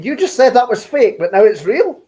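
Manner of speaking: loud, high-pitched mock disbelief; sarcastic challenge. Real, not acted. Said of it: "I'm being sarcastic back".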